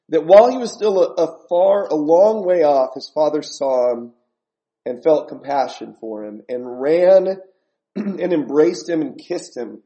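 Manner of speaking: loud, building preacher's proclamation; full-force preaching. Acted. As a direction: preach it out to the whole room.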